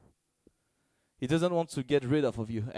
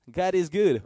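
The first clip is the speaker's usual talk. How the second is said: higher and louder, ringing out; joyful proclamation; 6 semitones higher, 7 dB louder than usual. Acted. Preacher shouts praise to the congregation, loud and joyful.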